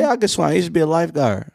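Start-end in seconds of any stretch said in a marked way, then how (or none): 0.0-0.5 defensive. Real, not acted. Insists he can swim.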